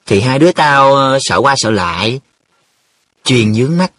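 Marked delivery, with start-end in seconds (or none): 0.0-2.2 higher, halting excuse; embarrassed hedging with a filler; flustered. A narrator's reading. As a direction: fumble for a face-saving answer. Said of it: "bối rối".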